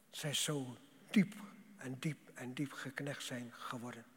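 hushed whisper sinking down; whispered sermon line. Acted. Preacher whispers the depth.

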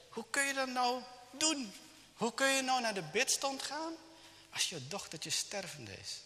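high-pitched acted indignation; reproachful grandmother. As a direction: play a grandma scolding in disbelief. Grandmother reproaches parents in story.

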